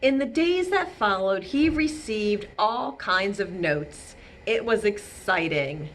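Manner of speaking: gentle read-aloud narration; storybook reading. A narrator's reading. Reading Elmore's story aloud to children, gently. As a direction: tell it like a bedtime story. Reading picture book to kids.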